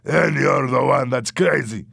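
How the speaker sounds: loud comeback; acted heated retort; angry retort. A narrator's reading. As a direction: throw the accusation back. Louis retorts in quarrel.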